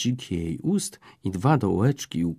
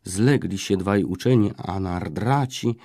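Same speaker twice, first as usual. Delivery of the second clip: usual speaking voice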